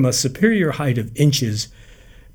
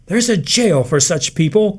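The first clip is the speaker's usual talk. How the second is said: higher, louder outcry; crying out; 7 semitones higher, 4 dB louder than usual. A narrator's reading. Martha crying out to family, higher and louder outrage. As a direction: cry it out in outrage. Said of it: "cried Martha Kahn".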